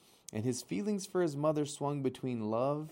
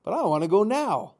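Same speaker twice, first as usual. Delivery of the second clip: whining outburst; 10 semitones higher, 12 dB louder, faster than usual. A narrator's reading. Gosling tantrum at mother.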